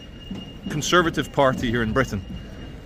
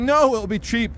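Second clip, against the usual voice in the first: 1 architect; 8 semitones higher than usual. Retold or imitated. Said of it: "The architect's like".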